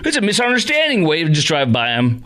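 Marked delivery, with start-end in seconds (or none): 0.0-1.0 mock innocent driver. Imitating the driver's excuse.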